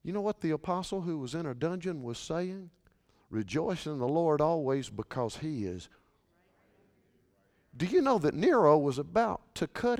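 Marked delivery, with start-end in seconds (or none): 3.3-5.9 soft hushed quote. Acted. Quiet quote before loud repeat.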